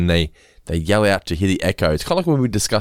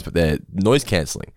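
usual speaking voice

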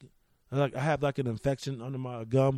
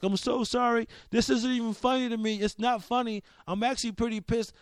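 pleading apology; 8 semitones higher than usual. Retold or imitated. Husband apologizing to his wife for laughing, high-pitched retold pleading. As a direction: plead in a raised pitch, imitating yourself mid-giggle-fit. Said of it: "I'm apologizing to her".

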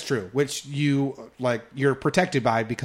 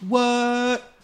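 mock surprise; 11 semitones higher than usual. Mock shock at spoiler.

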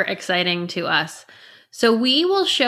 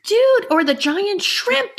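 high, drawn-out awe; mock amazement; 12 semitones higher, slower than usual. Riffing on boat ride sights, high mock amazement. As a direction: marvel in a high, stretched voice.

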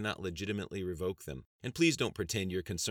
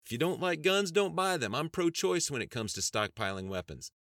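heated comeback; 10 semitones higher, 6 dB louder than usual. Acted. Congressman answering his shocked opponent, heated. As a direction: fire back loudly, then settle.

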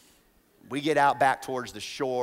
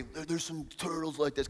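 imitating the guide; 8 dB quieter than usual. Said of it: "'cause the guy was talking like that".

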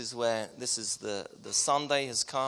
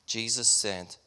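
scripture reading; 3 semitones lower, 4 dB quieter than usual. Acted.

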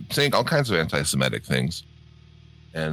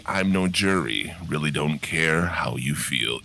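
normal talking voice

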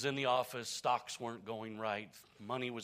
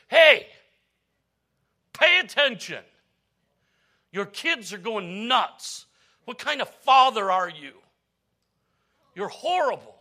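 much louder and higher angry yelling; furious shout; 9 semitones higher, 18 dB louder than usual. Acted. Stockbroker yelling at the silent father over his kids, furious. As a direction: explode at the man, blaming him.

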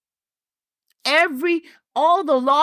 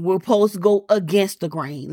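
preaching; 5 semitones lower than usual. Acted. Preaching to congregation.

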